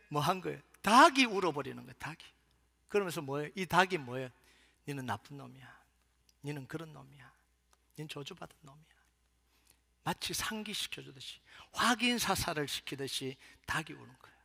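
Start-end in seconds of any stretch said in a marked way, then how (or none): 4.9-8.9 very soft, low condemnation; hushed, damning voice; quiet accusation. Acted. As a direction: pronounce each verdict under your breath. Voices the rooster's accusation to Peter.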